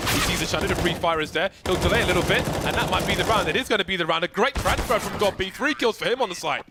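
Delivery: building to a loud, high call; excited. Real, not acted. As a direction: ramp up the hype as it lands. Caster building through a round.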